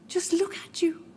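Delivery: hushed, sorrowful whisper; a grieving mother whispering to her son. Acted. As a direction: breathe it out in a whisper.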